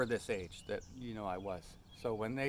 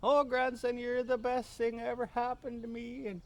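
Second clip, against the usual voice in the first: high, louder tearful gushing; much higher, mock-weepy old lady voice; crying drunk grandmother; 12 semitones higher, 4 dB louder than usual. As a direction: imitate a tipsy granny pouring out love. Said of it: "things they say when they're drunk".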